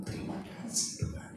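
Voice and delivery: whispered thank-you to God; a smiling listener thanking God in a whisper. Pretend. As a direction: breathe it out, barely voiced.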